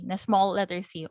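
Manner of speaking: normal talking voice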